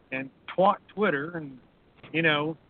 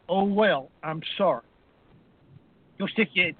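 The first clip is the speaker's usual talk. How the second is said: normal talking voice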